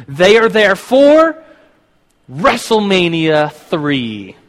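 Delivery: drawn-out, loud ring-announcer build; announcer hype. Acted. Hyping the wrestling event.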